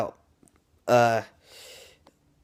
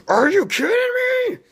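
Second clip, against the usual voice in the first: very high, loud mock screech; frustrated outburst; 6 dB louder, faster than usual. Reviewer voicing viewers at the cliffhanger ending, frustrated screech. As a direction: explode in hammed-up disbelief. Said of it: "everyone was frustrated at the ending".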